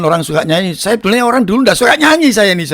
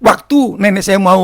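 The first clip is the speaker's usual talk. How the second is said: usual speaking voice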